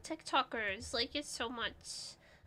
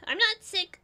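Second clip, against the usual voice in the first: higher, louder protest on not; insistent denial; 6 semitones higher, 8 dB louder than usual. Real, not acted. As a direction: push back hard on not. Denying being sick.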